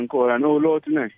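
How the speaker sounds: ordinary talk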